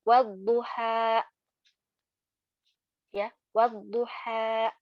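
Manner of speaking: wadduha drawn out slowly and clean; slow recitation demo. Shown as a demonstration. Teacher reciting wadduha slowly as a model. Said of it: "nggak ada cureknya di belakang".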